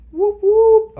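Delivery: loud high celebratory whoop; real whoop of relief. Real, not acted. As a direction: cheer it out at the top of your voice. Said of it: "Okay, sorry".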